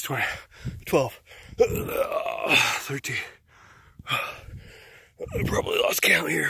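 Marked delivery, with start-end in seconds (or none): none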